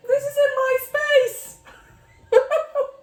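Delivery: very high, loud wail breaking into laughter; crying. Real, not acted. Writer embarrassed to cry on camera, tearful wailing laugh. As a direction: wail it through tears, half laughing at yourself. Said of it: "embarrassing to cry on camera".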